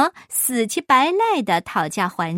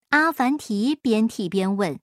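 usual speaking voice

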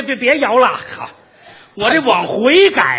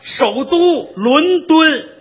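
首都伦敦 spelled out very slowly and higher; slow correction; 7 semitones higher, 3 dB louder, much slower than usual. Shown as a demonstration. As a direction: correct him word by word, higher. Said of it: "correcting partner's London". (放慢拔高纠正首都伦敦)

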